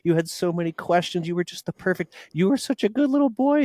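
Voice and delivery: high, sweet imitation of a fond sister; imitating sister. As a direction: coo it like his older sister. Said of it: "She said".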